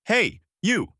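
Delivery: high, punchy hail; calling out. A narrator's reading. Luffy calling to the staring pirates, high yell. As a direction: call sharply to get their attention. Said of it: "Luffy yelled out".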